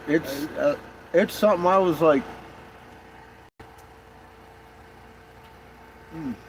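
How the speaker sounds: talking through a mouthful of pancake; words muffled by a bite of food; mouth full. Real, not acted. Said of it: "I had a pancake in my mouth".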